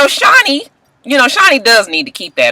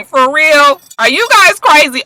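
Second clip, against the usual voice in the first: incredulous; 11 semitones higher, 4 dB louder than usual. Real, not acted. Mocking show's three-week claim.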